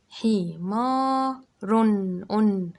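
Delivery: حي ما drawn out very slowly, then loud رن and أن; very slow syllables. Shown as a demonstration. Teacher sounds out slowly so children catch the final n. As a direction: crawl through each syllable. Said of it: "التنوين بالضم".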